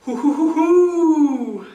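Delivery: high, loud hoot rising then falling away; owl hoot reply. A narrator's reading. Pa answering the owl with a loud, high hoot. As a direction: call back like one owl to another. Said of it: "Then he called back".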